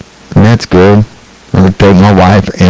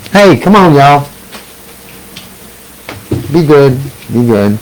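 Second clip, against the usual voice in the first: scolding the dogs; 4 semitones higher than usual. Real, not acted.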